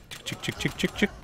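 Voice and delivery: whispered chick chick call; calling chickens. Pretend. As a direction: softly call the chickens over. Picking up minions like chickens.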